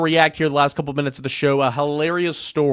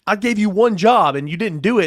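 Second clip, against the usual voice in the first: high, loud mock telling-off; angry boss; 8 semitones higher, 5 dB louder than usual. Boss on a phone call to an understudy, hammed-up angry scolding. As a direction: act out a boss berating you. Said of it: "you get a phone call from your boss".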